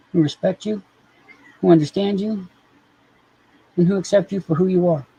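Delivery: high, tender reassurance for the cat; sweet high coaxing voice; soothing pet talk. Acted. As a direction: comfort a sulky kitty.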